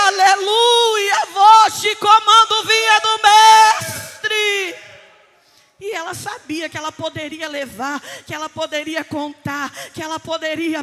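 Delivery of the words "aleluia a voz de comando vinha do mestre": slow, high, shouted proclamation; full-force preaching. Acted. Preacher proclaiming the master's command, fervent drawn-out shout. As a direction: stretch each word into a ringing shout.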